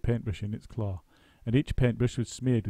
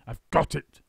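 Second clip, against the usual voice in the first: cried; 5 semitones higher, 5 dB louder than usual. A narrator's reading. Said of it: "cried Mister Twit".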